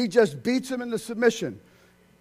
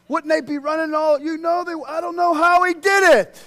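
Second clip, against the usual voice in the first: amazed; 9 semitones higher, 10 dB louder than usual. Real, not acted. Rising amazement.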